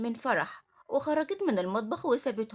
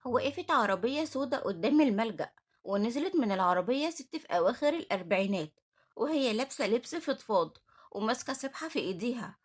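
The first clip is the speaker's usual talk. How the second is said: plain reading voice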